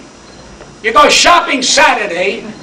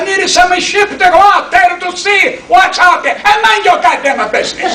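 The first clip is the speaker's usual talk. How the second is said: mock shout; 7 semitones higher than usual. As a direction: bellow it back at the doubters. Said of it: "He said".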